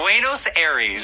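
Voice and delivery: Buenos Aires as "BWAY-nos AIR-eez", American style; American accent. Shown as a demonstration. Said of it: "that's how Americans say it".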